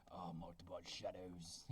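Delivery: very low, slow, quiet epic narrator voice; Game of Thrones voice. Acted. Reading the title to viewers in a deep Game of Thrones voice. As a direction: intone the title like a dark fantasy trailer. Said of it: "Game of Thrones voice".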